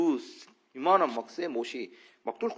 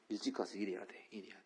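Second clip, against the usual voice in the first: whisper; 8 semitones lower, 16 dB quieter than usual. Retold or imitated. Father's voice at dawn.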